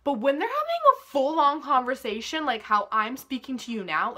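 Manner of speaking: loud, projected conversational voice; full-volume talking, pushed out. Real, not acted. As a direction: project it as if talking over a room. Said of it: "in a voice that is raised and projected".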